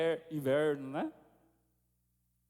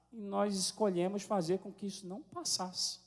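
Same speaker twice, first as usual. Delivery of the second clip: normal talking voice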